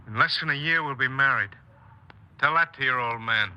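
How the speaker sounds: put-on angry young man snapping back at his girl; defiant anger. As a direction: throw it back at her, raising your voice. Jim angrily retorts to Ellen.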